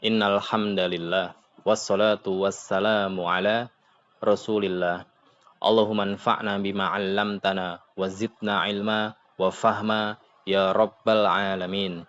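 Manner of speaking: low, soft recited Arabic prayer; opening prayer. Real, not acted. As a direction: recite the opening du'a softly. Opening prayer before lesson. (doa pembuka yang dilantunkan pelan)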